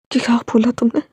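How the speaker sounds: plain reading voice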